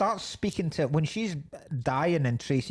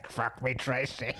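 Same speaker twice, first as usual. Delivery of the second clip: mock No-Face voice; 3 semitones lower, 4 dB quieter than usual. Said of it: "Do, do the voice".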